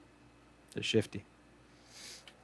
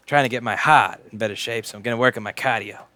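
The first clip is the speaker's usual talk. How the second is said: ordinary delivery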